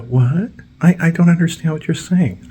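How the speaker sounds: very high confused voice; high mock confusion; mock clueless worker. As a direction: play dumb up high. Mocking dazed lawnmower man.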